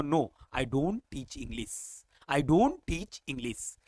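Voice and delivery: slow, soft demo negative; slow model answer. Shown as a demonstration. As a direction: spell out the no answer. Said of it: "तो बोलूंगा".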